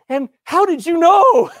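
loud, high, drawn-out astonished question; amazed outcry. Retold or imitated. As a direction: cry out in amazement, retelling the moment. Retold amazed question to pastor.

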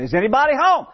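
high call into a dark house; hollering out. Retold or imitated. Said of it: "so he said it again".

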